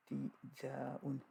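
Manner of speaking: "ibtidaun" drawn out syllable by syllable; sounded out slowly. Shown as a demonstration. Teacher spelling out a term for students, slowly sounded out. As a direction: stretch "ibtidaun" out slowly, in separate pieces.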